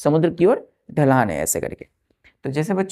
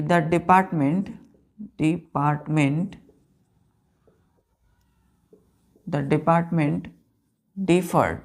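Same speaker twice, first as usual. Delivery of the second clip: slow dictation; much slower than usual. Shown as a demonstration. Teacher dictating to class.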